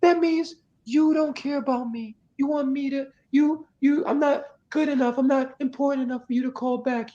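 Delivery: squeaky self-pity that trails away; hammed-up insecure whine. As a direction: act the spiralling needy partner. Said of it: "now I'm consuming thoughts, mine is racing".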